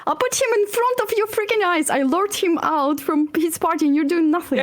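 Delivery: exasperated rant, voice up high; real frustration. Real, not acted. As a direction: vent at the player who did nothing. Player angry at teammate.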